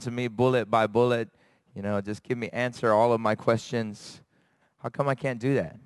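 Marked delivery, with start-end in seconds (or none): none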